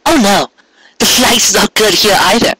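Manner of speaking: high coy mare feigning a problem; high sing-song pretend worry; playful mock dismay. A narrator's reading. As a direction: tease him with a fake complaint, high. Cupcake's voiced lines.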